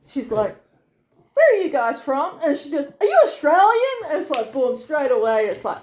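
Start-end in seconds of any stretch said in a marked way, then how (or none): 1.3-2.4 higher, louder, perky customer greeting; bright-eyed curiosity, acted out loud; eager retold question. Retelling a keen service worker's question. 3.0-4.0 much higher and louder, delighted guess; gushing, pitched-up mock astonishment; excited retold question. Retelling the worker's instant guess.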